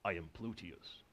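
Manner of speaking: a low, quiet, mock-grand announcement of the name; lightly voiced mythic figure naming himself; grand character voice. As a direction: announce yourself like a storybook character, but lightly. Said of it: "It sounds like a character".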